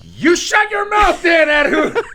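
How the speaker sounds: high, loud, slow playful fury; mock furious yell, laughing; mock angry shout. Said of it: "I'm ready for a fight".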